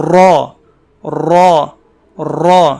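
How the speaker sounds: را full-mouthed with a trilled ر; rolled heavy ra. Shown as a demonstration. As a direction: roll the ر of را and fill the mouth. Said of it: "یہ تکرار کی آواز". (منہ بھر کے لرزتی را)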